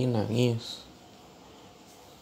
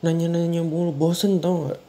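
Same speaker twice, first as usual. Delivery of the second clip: loud, raised complaint, higher; angry yell; 5 semitones higher, 6 dB louder than usual. Acted. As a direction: yell it in exasperation. Suami marah ditanya-tanya. (keluhan marah dengan suara keras)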